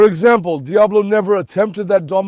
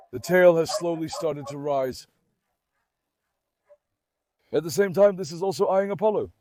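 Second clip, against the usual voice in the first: softer, murmured observation; quiet play-by-play watching the standoff; hushed commentary; 8 dB quieter than usual. Real, not acted. Hushed commentary on tense dogs.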